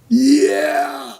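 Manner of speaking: long, high-pitched action-hero yell; triumphant mock cheer. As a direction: stretch it out like a big heroic entrance. Acting out a heroic entrance.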